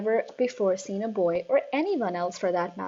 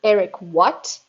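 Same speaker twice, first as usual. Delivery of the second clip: loud demanding shout; 9 dB louder, slower than usual. A narrator's reading. Headmistress shouting at Eric, loud. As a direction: bark the question at him. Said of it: "the Trunchbull shouted".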